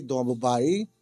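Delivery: normal talking voice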